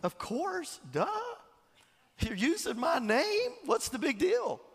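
high, breezy "of course, duh"; lightly voiced teasing Jesus; mock Jesus. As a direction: brush it off like it's obvious. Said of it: "he's like".

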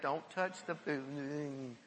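slow, low, soft fussy warning; mock a fussy germ-phobe, drawn out; lightly voiced neat freak scolding. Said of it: "how many of you neat freaks like".

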